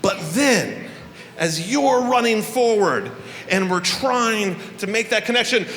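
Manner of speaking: normal talking voice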